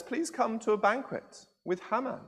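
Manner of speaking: lightly voiced queen asking calmly. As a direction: Esther calmly invites the king, nothing wrong. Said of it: "she very calmly says".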